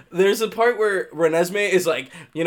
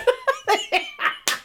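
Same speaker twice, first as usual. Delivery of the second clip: breathy laugh. Real, not acted. Laughing at scene.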